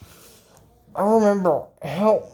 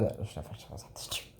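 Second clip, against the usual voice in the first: whispered aside; 12 semitones lower, 17 dB quieter than usual. Real, not acted. Aside about vegetables on the tree.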